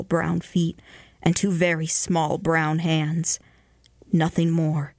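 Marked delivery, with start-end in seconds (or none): none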